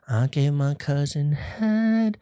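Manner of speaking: drawn-out, oddly voiced confession; slow odd voice. Vlogger hams up his big reveal, slow and odd. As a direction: stretch each word in a strange put-on voice.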